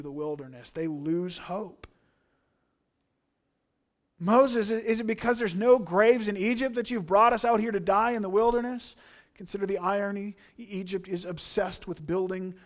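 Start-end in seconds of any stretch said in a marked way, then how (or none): 4.2-9.0 raised, louder accusing cry; high, loud whining accusation; mock complaint. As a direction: voice the people's bitter complaint to Moses. Preacher voicing Israelites' complaint.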